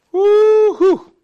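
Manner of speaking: very high, loud woo-hoo; exultant whoop. Real, not acted. Preacher overcome while turning to the Psalm, whooping. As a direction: let out a long ringing whoop.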